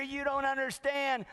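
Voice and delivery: high-pitched, hammed-up desperate cry; frantic. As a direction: plead in an exaggerated panic, pitch way up. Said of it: "one guy is just frantic".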